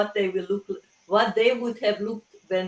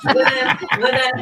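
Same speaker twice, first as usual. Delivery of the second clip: laughing through the words; chuckling while she talks; 4 semitones higher, 7 dB louder than usual. Real, not acted. Laughing at joke about aging.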